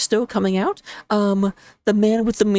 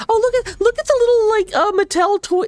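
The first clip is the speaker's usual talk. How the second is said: high delighted squeal; sudden high pitched delight; excited squeal; 10 semitones higher than usual. Real, not acted. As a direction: gush over the little toy soldiers. Spots the toy-like heroes.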